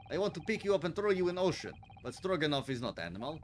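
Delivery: put-on loud, boastful Russian-accented guard. Sailor boasting to visitors about his post, Russian accent. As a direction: proclaim it in a heavy Russian accent. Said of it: "Russian sailor character continues".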